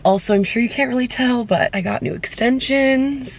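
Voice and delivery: ordinary delivery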